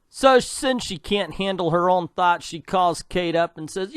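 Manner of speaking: ordinary talk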